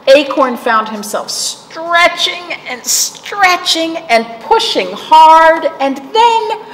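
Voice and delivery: high, building effortful voice; straining. A narrator's reading. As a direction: push as if growing upward. Narrator strains with growing Acorn.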